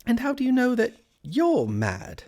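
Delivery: high, pointed question; questioning Alice. A narrator's reading. Alice questioning the Cat, pitched high.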